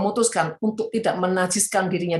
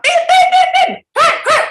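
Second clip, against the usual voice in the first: high mock yell of an impatient driver; honking and yelling; 8 dB louder than usual. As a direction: shout like a driver stuck in the car park. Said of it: "Wah, teriak-teriak". (teriakan pengemudi yang tidak sabar di parkiran)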